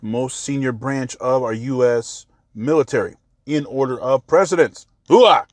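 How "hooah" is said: barked Army battle cry, high and loud; loud gung-ho soldier. Acted. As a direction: shout it like a proud soldier. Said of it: "that's an Army thing".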